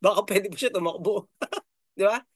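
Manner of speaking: real chuckle running through the words; chuckling. Real, not acted. Vlogger guessing a candidate may yet run, chuckling. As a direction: say it through a light laugh.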